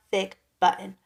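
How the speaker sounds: usual speaking voice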